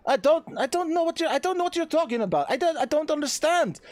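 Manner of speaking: high, bewildered protest; panicked pleading. Acted. Accused lord pleading with his friend the captain, panicked.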